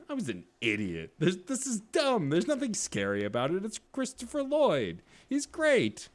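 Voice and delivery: high-pitched exasperated disbelief; incredulous self-mockery. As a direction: scoff at your silly old fear, voice climbing high. Mocks his own childhood fear.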